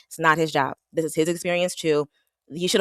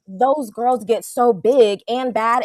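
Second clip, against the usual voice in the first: mocking drawl; 6 semitones higher, much slower than usual. Real, not acted. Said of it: "mocking exaggerated delivery".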